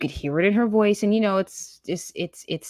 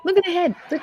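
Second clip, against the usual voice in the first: much higher, laughing; high laughing jeer; 13 semitones higher, faster than usual. Real, not acted. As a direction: crack up while pointing it out. Laughing at the clip.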